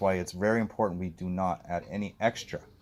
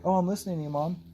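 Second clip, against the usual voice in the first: voicing the dog; 10 semitones higher than usual. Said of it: "Finally, he's like".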